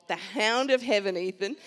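emphatic. Real, not acted. Preacher calling out the hound of heaven. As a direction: call it out with force.

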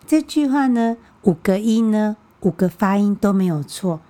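ordinary delivery

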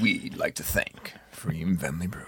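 mock movie-trailer announcer; Hollywood voice. Host jokingly redoing the sponsor read, Hollywood voice. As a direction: ham it up as a Hollywood voice-over. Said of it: "we'll do my Hollywood voice".

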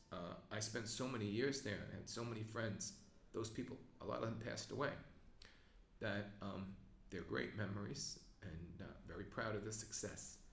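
holding back tears as he remembers; emotional. Real, not acted. Recalling friends who passed away, quietly moved. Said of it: "I'm emotional because".

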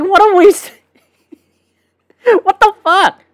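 mock disgust. Ranting quote of his own reaction.

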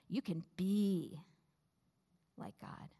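weight on "be", "like God" breathed out almost silent; the tempter pressing on "be"; stress on BE. Shown as a demonstration. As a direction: hit "be", whisper the end. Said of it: "emphasizing different words each time".

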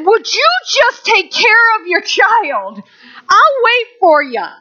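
high, insistent mock pleading; exasperated, high-pitched retold request; acted exasperation. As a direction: replay losing patience with an oblivious parent. Retells scolding an oblivious mother.

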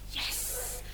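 a breathless private cheer; hearing her name called, a whispered yes; excited yes. Retold or imitated. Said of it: "I was like".

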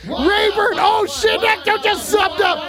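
shouting with joy at the sub alert; excited shouting. Real, not acted. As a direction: yell it up for the new sub. New sub alert hype.

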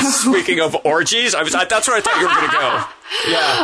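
laughing interjection, pitch climbing; amused, high-pitched teasing; joking, laughing. Real, not acted. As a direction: jump in teasingly, breaking into laughter. Jokey interruption of the segue.

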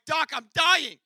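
high, loud mock agony; loud hammed-up plea of pain; dramatic dying wail. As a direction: ham up dying to the doctor. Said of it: "I'm like".

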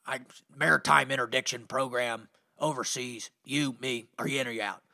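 lightly voiced friend asking straight out; voicing his buddy. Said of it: "It's like".

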